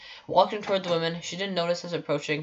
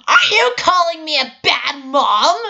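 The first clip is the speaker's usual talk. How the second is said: high, loud, slow mock screech; screeching mother; 11 semitones higher, 10 dB louder, slower than usual. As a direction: screech it like an offended mom. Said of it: "she screeched".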